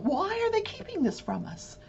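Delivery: imagined juror muttering to herself; puzzled juror. Pretend. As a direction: wonder aloud as a curious juror, trailing into a whisper. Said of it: "I'm sure they're sitting there going".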